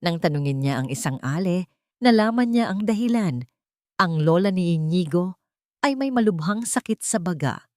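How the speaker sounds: ordinary delivery, nothing marked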